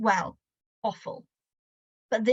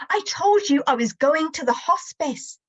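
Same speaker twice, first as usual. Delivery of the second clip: mother shouting back; 6 semitones higher than usual. Acted. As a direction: flare up at him. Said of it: "she shouts back".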